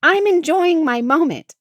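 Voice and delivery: loud, high snap at her husband; wife snapping at husband at the zoo; snapping back. Retold or imitated. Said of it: "I snapped at him".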